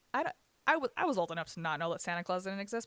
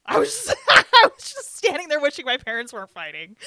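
loud, high protest broken by a laugh; exasperated protest; 8 semitones higher, 16 dB louder than usual. Real, not acted. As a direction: protest your innocence, voice leaping up. Protesting innocence, laughing through hurt.

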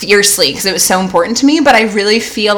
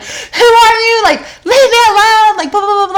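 frightened yelling; 13 semitones higher than usual. Retold or imitated. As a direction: shout it out, terrified, into the dark room. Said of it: "I start, like, yelling".

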